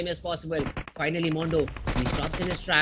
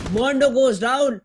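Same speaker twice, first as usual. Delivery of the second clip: esports caster; 8 semitones higher than usual. Real, not acted. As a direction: call the final fight with mounting hype. Clutch fight climax.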